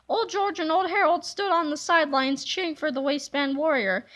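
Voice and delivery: ordinary delivery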